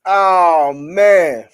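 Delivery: loud, drawn-out oh man, cracking up. Real, not acted. Streamer losing it over a chat joke, loud exclamation. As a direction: belt out the disbelief at the joke.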